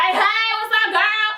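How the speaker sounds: high, animated hello; hyped YouTuber greeting. Retold or imitated. Vlogger as fans expect her in person, high and hyped. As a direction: greet a fan in full on-camera pep. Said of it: "y'all think I'm gonna be like".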